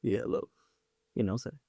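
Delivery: lightly deepened playboy hello; put-on deep voice. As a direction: drop the voice a bit to sound cool. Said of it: "I gotta put my deep voice on".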